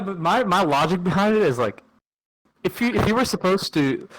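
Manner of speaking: usual speaking voice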